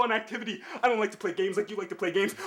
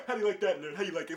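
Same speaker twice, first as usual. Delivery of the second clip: ordinary delivery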